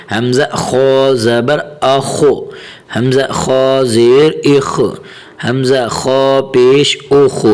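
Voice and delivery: slow spelling with a thick, heavy خ in اخ; heavy خ recitation. Shown as a demonstration. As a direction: recite slowly and make the خ of اخ full. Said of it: "حرف خا کو ہمیشہ موٹا پڑھا جائے گا".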